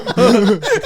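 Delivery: high, loud burst of laughing; real laughter. Real, not acted. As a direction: crack up. Laughing fit.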